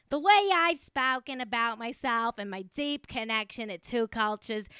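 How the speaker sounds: put-on broad Boston accent, dropped r's; mock Boston accent. Host redoes the celebrity's statement, mock Boston accent. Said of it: "do the whole thing again in a Boston accent".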